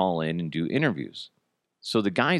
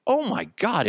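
re-enacted wide-open astonishment; astonished; 12 semitones higher than usual. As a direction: draw it out, floored by the discovery. Retelling his astonishment.

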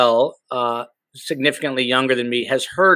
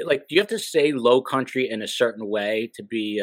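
ordinary talk, nothing marked

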